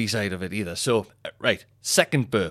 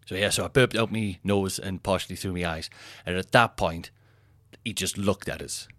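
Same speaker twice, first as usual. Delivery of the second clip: ordinary talk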